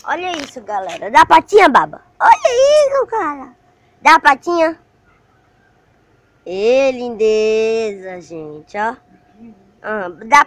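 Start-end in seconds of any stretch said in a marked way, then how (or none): 1.1-4.8 eager pet talk. Real, not acted. Loud eager commands to the dog.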